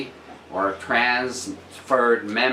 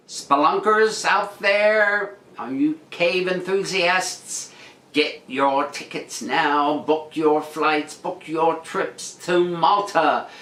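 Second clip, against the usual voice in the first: mock advert pitch; 6 semitones higher, slower than usual.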